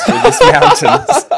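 breaking into laughter mid-sentence, slowing down; words squeezed out through real laughter; laughing. Real, not acted. Telling friends the wrong-guide punchline, laughing.

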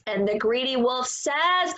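ordinary delivery

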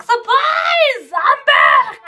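sarcastic hammed-up surprise yell; mock surprise shout. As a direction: jump out like a ghost back from the dead. Mocking dead character returning.

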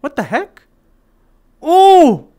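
ordinary talk, nothing marked

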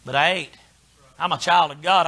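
ordinary talk, nothing marked

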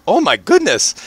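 high-pitched startled exclamation. Acted. Character sees a dragon sitting there, startled. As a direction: yelp it in acted alarm.

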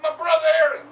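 loud crying shout; crying plea. Acted. Preacher as Moses crying out for his brother Aaron. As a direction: cry out for help.

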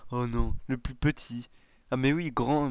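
usual speaking voice

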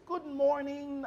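bright, high, drawn-out greeting; ringing good morning. Shown as a demonstration. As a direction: let the morning ring, slow and high. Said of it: "I hear a ring in their voice".

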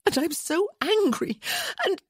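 very high, choked-off angry cry; voice breaking. A narrator's reading. Woman fighting back tears, voice breaking. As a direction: near tears, pitch soaring, then stop. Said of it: "hearing her voice break".

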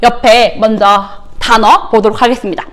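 usual speaking voice

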